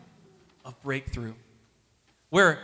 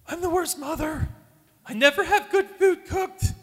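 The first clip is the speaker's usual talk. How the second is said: high, mock-despairing wife voice; wife's upset lament, voiced high; imitating his wife; 10 semitones higher than usual. Husband retelling his wife's self-blame, in a high put-on voice. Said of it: "My wife Emily".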